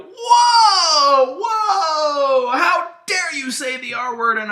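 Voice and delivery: high, loud fake-offended cry; hammed-up offended wail; mock outrage. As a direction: overact the outrage, shrieking. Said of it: "And then I go".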